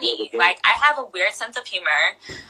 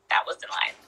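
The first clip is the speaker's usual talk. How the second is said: ordinary delivery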